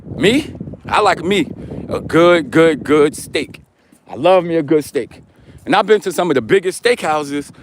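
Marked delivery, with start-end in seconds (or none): 0.9-5.2 savoring. Real, not acted. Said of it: "savoring steak".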